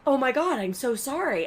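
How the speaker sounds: mock drunk apology. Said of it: "he's like".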